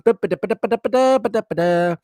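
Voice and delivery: high, run-on ba-da noodling; nonsense ba-da filler. Pretend. Host filling a gap on the live show, high ba-da babble. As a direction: babble a string of ba-das to fill the gap.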